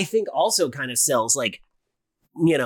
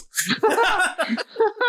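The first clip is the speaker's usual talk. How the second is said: high rising laugh. Real, not acted. Laugh after story.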